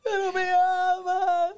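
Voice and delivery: high, slow whimpering plea; mock weepy. Wife crying that her husband loves her, hammed-up sob. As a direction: whine it like a tearful wife. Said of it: "pues empezó a llorar".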